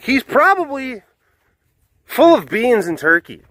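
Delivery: high-pitched, mock-dismissive villager; lightly hammed-up scoffing; dismissive townspeople. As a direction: wave off the false alarm. Townspeople dismiss the boy's alarm.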